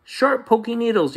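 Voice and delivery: high-pitched complaining yell; yelling child. Acted. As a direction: cry it out like a hurt kid. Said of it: "yelled Tate".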